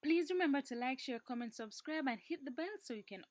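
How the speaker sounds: loud, high YouTuber outro; bright sign-off shout. Real, not acted. Channel outro call to action.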